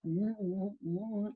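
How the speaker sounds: soft, distracted murmurs of agreement; half-listening to a chattering child; quiet, tuned-out mm-hmms; absent mm-hmm. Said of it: "I go".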